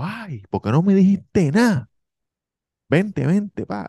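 imitating a sweet flight attendant; gushing attendant. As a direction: gush like an apologetic hostess.

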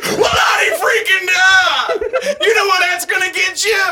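loud high laughing shout; Chris Farley impression. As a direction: bellow it like a manic comic. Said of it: "Chris Farley".

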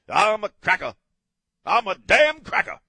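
loud, high mock holler; hammed-up shout. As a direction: belt it out as a comic self-declaration. Mock proclaiming himself a cracker.

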